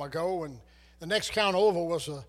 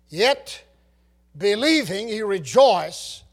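emphatic preaching; 2 semitones higher, 10 dB louder, slower than usual. Acted. Preacher repeats the key verse.

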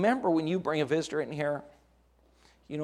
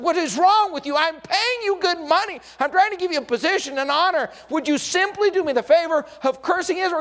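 much higher and louder, exasperated demands; angry outburst; 14 semitones higher, 8 dB louder than usual. Acted. Balak berating the prophet who won't curse Israel, angry and loud. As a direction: blast him for failing to deliver. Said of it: "And Balak is getting angry".

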